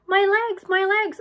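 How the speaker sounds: high, loud panicked cry. A narrator's reading. Child crying out to mother about her legs, panicked. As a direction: wail it out in fear. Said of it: "I cried".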